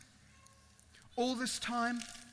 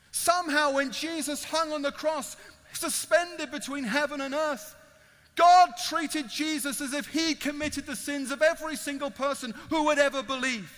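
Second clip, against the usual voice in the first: loud, raised pulpit delivery; emphatic preaching; 4 semitones higher, 7 dB louder than usual. Real, not acted. Preacher to listeners about Jesus on the cross, loud emphatic preaching. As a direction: preach it out with forceful stresses.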